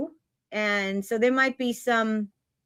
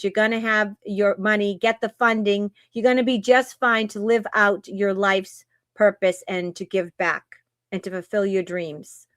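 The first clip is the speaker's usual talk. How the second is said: normal talking voice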